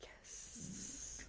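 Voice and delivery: breathy whispered yes. Real, not acted. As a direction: whisper it aside. Whispered aside.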